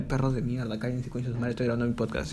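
low-volume grumble away from the audience; very soft, irritated aside; muttered scolding aside. Real, not acted. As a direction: scold the dogs under your breath. Aside scolding dogs while recording.

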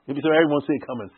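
rushed fast repeat of He'll be so arrogant. Shown as a demonstration. Teacher repeats the line fast to the class. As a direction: rattle it off quickly. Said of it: "And he'll be so arrogant".

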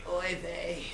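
Mort voice. Pretend. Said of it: "You sounded like that dude from fucking Family Guy".

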